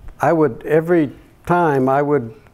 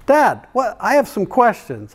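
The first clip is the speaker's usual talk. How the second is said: little daughter's voice; 10 semitones higher, 3 dB louder than usual. Retold or imitated. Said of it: "And she said".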